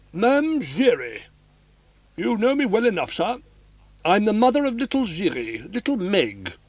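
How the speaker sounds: put-on a homely old boxkeeper, a bit indignant; old boxkeeper woman. A narrator's reading.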